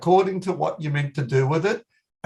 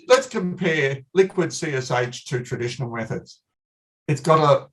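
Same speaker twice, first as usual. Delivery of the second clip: ordinary delivery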